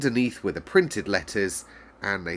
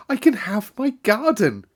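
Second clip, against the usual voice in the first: high, loud burst of joy; girl crying out her good news; excited cry; 13 semitones higher, 7 dB louder than usual. A narrator's reading. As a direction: blurt it out thrilled. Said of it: "cried Mary".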